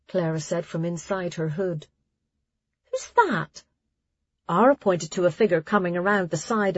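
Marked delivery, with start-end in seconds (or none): 2.9-3.6 surprised. Acted. Startled question at stranger.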